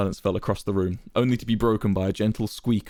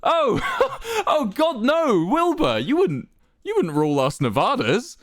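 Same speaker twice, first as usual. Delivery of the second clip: high, loud, incredulous chuckle; amused chuckling; 14 semitones higher, 4 dB louder, slower than usual. A narrator's reading. Quackity laughing off Wilbur's wish to rule, chuckling. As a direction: laugh off the very idea, pitched high. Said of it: "his eyes lit up and he chuckled".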